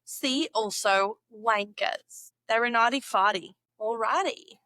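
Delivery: ordinary talk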